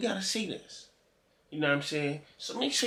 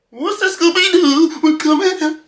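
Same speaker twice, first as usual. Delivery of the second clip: loud, high-pitched hollering in mock panic; panicked yelling; 13 semitones higher, 14 dB louder than usual. Reactor acting out someone fleeing the ghost, high panicked yell. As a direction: yell it out like you are bolting from a ghost. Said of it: "It'd have been like this".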